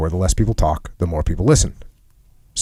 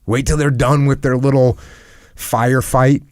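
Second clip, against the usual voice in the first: usual speaking voice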